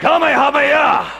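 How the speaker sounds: huge high battle yell of Kamehameha; shouting Kamehameha. Shown as a demonstration. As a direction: scream Kamehameha like an anime attack. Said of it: "Shout it".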